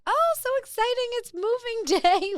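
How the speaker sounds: tone-deaf chirpy manager. Retold or imitated. Imitating the manager gushing to the divorcing coworker. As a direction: gush like an oblivious boss. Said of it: "she would just be like".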